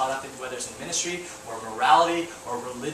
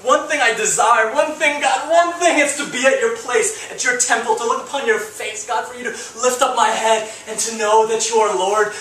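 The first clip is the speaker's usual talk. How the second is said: much higher and louder, fervent pleading; David's pleading prayer; 7 semitones higher, 6 dB louder than usual. Retold or imitated. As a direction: beg God like David on the floor. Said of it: "He was like".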